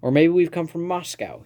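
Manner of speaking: mock Russian accent. A narrator's reading. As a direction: lift the pitch with a hint of Russian. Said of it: "He has a good Russian accent".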